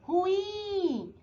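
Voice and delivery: ruim with IM drawn out high; a long, high IM ending "ruim"; stressed last syllable. Shown as a demonstration. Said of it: "Última sílaba tônica".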